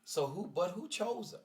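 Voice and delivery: high, challenging grandma voice; grandmother. Retold or imitated. Grandmother asking grandson who chose her, high challenging grandma imitation. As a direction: imitate grandma putting it squarely on him. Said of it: "she said".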